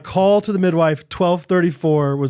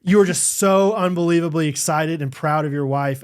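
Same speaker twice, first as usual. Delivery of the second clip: ordinary delivery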